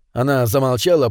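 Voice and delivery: usual speaking voice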